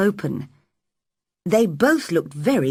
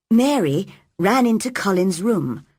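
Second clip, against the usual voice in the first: ordinary delivery, nothing marked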